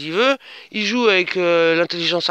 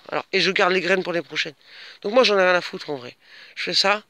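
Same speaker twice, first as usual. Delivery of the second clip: normal talking voice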